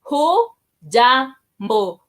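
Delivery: Hujambo drawn out syllable by syllable; very slow demo. Shown as a demonstration. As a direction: spell hujambo out slowly for learners. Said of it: "it's a hello word in Swahili".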